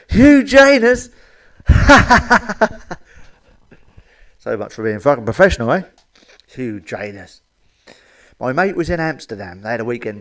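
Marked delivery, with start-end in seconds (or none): 0.1-1.1 much higher, louder, slow "Hugh Janus?" stretched in disbelief; shocked exclamation. Acted. Nurse shocked by the name. 1.7-2.9 high loud burst of laughter; loud cackle. Real, not acted. Laughs hard at the name. 6.5-7.4 softer, lower "Hugh Janus." savoured under the breath; quiet repeat. Real, not acted.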